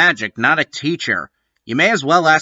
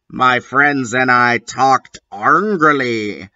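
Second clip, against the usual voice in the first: ordinary talk, nothing marked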